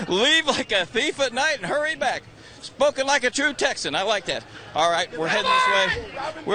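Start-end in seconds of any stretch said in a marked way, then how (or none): 0.1-2.2 high, amused echo of another's line; gleeful repeating. Retold or imitated. Reporter echoes a Texan's advice on live TV, gleeful repeating. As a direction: repeat it with raised pitch, relishing it.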